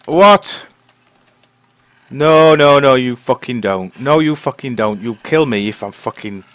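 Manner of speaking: panicked shout. Real, not acted. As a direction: yell in panic at the wolf. Wolf attacks, panic.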